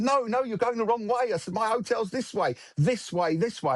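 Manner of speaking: desperate, high, repeating this way over and over; panicked pleading. Retold or imitated. Panicking at non-English driver.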